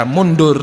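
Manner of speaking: mundur, the joke reading of the same letters; vowel reading demo. Shown as a demonstration. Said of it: "itu boleh dibaca dengan beragam".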